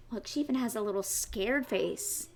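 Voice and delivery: ordinary delivery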